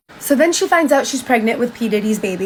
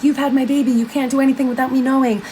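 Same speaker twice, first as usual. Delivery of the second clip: higher-pitched, controlled retelling of a jealous outburst; imitated angry accusation; 4 semitones higher than usual. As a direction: voice a possessive partner's complaint, not shouting. Retold jealous quarrel, controlling partner.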